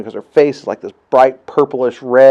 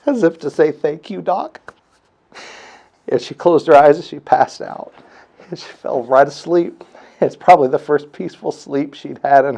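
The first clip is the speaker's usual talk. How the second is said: choked up; 3 semitones higher than usual. Real, not acted. Father recalling his baby's first smile, choked up. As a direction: voice catching with real emotion.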